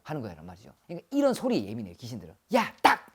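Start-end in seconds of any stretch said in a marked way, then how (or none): 2.6-3.1 sudden short yell and crack; sharp startling noise. Shown as a demonstration. Shaman showing sounds spirits react to, sharp yell. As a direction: shout it abruptly to startle spirits.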